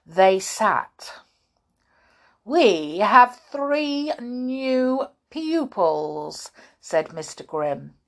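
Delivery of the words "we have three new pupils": loud, high, slow announcement; stern schoolmaster. A narrator's reading. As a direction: draw it out like a strict headmaster. Voices stern schoolmaster Grimm.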